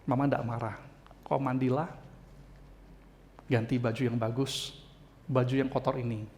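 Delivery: put-on low, soft reassurance to a child; gently forgiving mother. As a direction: voice a calm mother soothing her child. Forgiving mother voiced in story.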